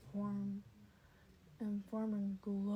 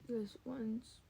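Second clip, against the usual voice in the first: ordinary delivery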